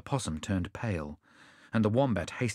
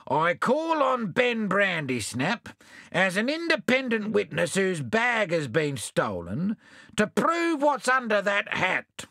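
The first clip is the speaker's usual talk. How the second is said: pompous sailor; 10 semitones higher than usual. Acted. Bill calls a witness.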